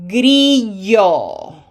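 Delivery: Grillo said loud and clear, smiling on the i; Spanish pronunciation model. Shown as a demonstration. Teacher modelling a Spanish word for students, loud and clear. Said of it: "We have to smile".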